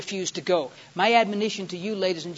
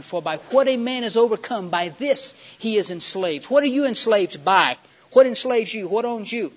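raised, emphatic preacher's challenge; forceful preaching; 4 semitones higher, 9 dB louder than usual. Real, not acted. Preacher asking the congregation what enslaves them, forceful. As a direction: press each question on the congregation, loudly.

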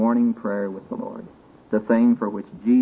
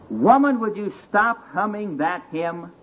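put-on bellowing irritated drunk; gruff drunk shout; 7 semitones higher than usual. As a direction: bark it at her, fed up. Drunk shouts at humming girl.

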